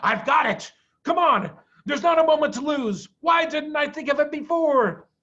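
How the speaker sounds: a father rallying his family; excited shout. A narrator's reading. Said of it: "he jumped up and shouted".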